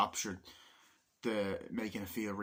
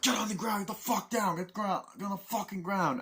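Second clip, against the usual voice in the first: high-pitched, frantic commanding yell; re-enacted panicky army command; acted soldier's order; 9 semitones higher than usual. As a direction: order them down in a raised, urgent voice. Acts out soldiers ordering villagers down.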